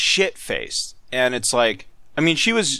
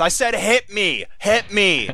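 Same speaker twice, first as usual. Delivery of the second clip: put-on slow, higher, insistent drunk; drunk slurring; 8 semitones higher, slower than usual. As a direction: demand it, slurring and stubborn. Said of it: "slurring her words".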